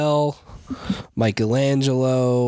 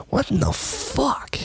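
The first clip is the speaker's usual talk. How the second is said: stunned whisper. Real, not acted. Reacting to repeated read line.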